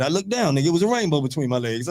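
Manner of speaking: normal talking voice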